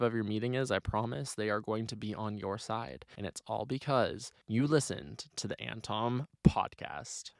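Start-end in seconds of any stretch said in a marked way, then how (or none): none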